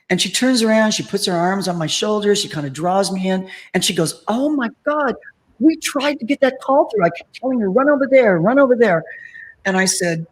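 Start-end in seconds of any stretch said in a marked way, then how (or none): 4.3-9.0 imitating an excited star, higher pitched; animated, high-pitched quote of someone thrilled; excited retelling. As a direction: gush it as a retold outburst. Star retells her excitement at meeting.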